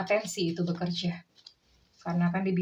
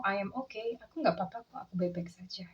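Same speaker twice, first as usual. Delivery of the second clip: quiet brush-off; 7 dB quieter, faster than usual. Retold or imitated. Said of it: "dia mengatakan kepada kamu".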